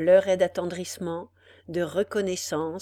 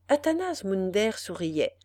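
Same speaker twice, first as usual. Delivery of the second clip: ordinary delivery, nothing marked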